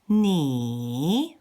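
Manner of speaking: long "ni" dipping, then climbing back up; drawn-out "ni" with a fall-then-rise tone; third tone. Shown as a demonstration. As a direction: swoop "ni" down and up again. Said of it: "Let's do them all together again".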